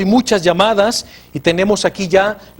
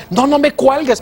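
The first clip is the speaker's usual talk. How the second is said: higher, pleading, imitating a scared caller; imitated panic, pitched up and a bit louder; mock frightened plea; 9 semitones higher, 3 dB louder than usual. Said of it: "tengo mucho miedo".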